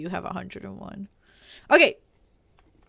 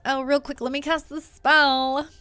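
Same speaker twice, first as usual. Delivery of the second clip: put-on Valley Girl voice; 4 semitones higher than usual. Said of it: "like, a Valley Girl voice".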